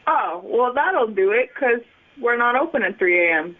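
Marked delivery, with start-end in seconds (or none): none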